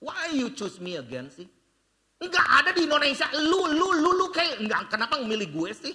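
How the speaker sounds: mock exasperated refusal; exasperated protest. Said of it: "aku ngomong begini ke tim internasional".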